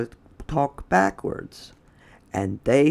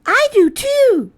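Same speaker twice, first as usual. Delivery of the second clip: very high, louder put-on voice; Lily's voice; 8 dB louder than usual. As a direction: pipe the line way up high. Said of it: "said Lily".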